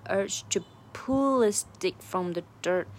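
"pull" leaned on and drawn out slowly; heavy accent on "pull"; stress on PULL. Shown as a demonstration. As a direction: make "pull" stand out, "urge to" light. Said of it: "这个pull也要重读出来".

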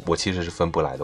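normal talking voice

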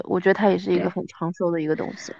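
normal talking voice

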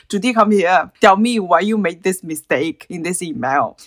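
put-on stern boss in an Indian accent; Mayank's Indian accent. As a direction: mimic a strict Indian manager. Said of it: "我也会学他印度口音".

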